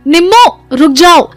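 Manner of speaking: loud, high call to stop her. A narrator's reading. Rajbir chasing Nimmo, urging her to stop, loud call. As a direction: shout after her to make her stop. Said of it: "Rajbir ने रोका".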